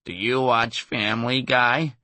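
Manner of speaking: slow, drawn-out cartoon voice; imitating a Family Guy character, dragged out. Impressionist quizzing the comic after a gig, slow cartoon voice. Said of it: "in what may have been the voice of a Family Guy character".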